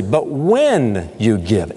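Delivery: slow reading, with "when" clearly stressed. Shown as a demonstration. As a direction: lean hard on the word "when" and take it slowly. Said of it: "emphasis on the word when".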